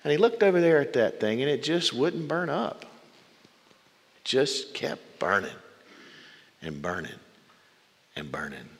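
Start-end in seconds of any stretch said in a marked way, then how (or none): 4.2-8.7 each burning softer and lower, trailing away; fading repetition. A narrator's reading. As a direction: let the words burn down to nothing. Bush keeps burning, voice fading.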